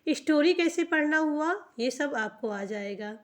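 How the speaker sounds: usual speaking voice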